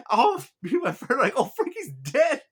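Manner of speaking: high, loud, cracking up while talking; laughing hard. Real, not acted. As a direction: barely get it out through real laughter. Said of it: "I'm laughing so hard".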